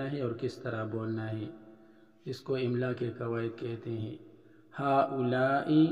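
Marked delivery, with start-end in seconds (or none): 4.7-5.9 हाउलाई drawn out slowly, long aa after ha; pronounced with alif. Shown as a demonstration. Teacher showing students the long aa in हाउलाई. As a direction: say हाउलाई sounding the alif clearly. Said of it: "पढ़ने में हाउलाई अलिफ के साथ पढ़ा जाता है".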